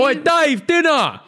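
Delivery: calling out high across the house; hammed-up wife yelling for her husband; mock call. Wife calling Dave in for dinner, mock shout.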